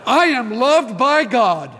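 loud, high, rousing preacher's call; fervent altar-call preaching; full-force preaching. Acted. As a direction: lift the whole room, preach it out loud.

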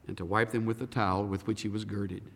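normal talking voice

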